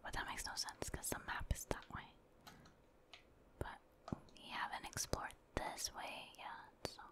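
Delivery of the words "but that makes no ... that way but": usual speaking voice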